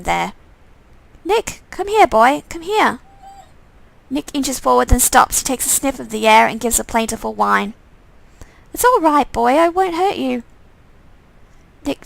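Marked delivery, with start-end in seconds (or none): 1.2-3.0 high coaxing call to a scared dog. Pretend. Player coaxing the cowering dog, calling high. 8.7-10.4 higher, gentle reassurance; soothing the dog. Pretend. Player reassuring the frightened dog, gently higher.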